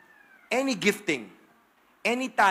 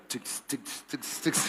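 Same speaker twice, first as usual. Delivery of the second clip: beat sounds; 6 semitones lower, 4 dB quieter, much slower than usual. Retold or imitated. Imitating her bar dance beat.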